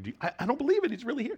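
high-pitched, stammering disbelief; squeaky-high mock amazement, tripping over the words; freaked out and excited. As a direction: act out overjoyed, stunned disciples. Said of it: "they're so freaked out and excited".

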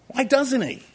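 high pleading question. Acted. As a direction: cry the question out high to the heavens. Preacher repeats rhetorical question.

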